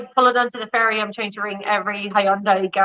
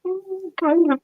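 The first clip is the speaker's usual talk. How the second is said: high, hesitant mumble; mock sheepish kind of; reluctant admission; 9 semitones higher, 3 dB quieter, slower than usual. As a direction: admit it sheepishly. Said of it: "And I was like".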